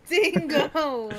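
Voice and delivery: laughing. Real, not acted. Laughing echo.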